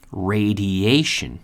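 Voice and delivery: slow "Radiation", ray-dee-AY-shun; model pronunciation of radiation. Shown as a demonstration. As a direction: draw out the AY in "Radiation". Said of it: "or the pronunciation".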